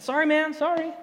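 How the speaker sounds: high, pleading apology to the angry surfer; high apologetic sorry. Retold or imitated. To the angry surfer he cut off, a high pleading sorry. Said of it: "and I said".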